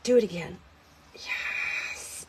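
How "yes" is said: drawn-out whispered yes of satisfaction; long, gleeful whisper; whispered relish. Real, not acted. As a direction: hiss it softly, savoring the moment.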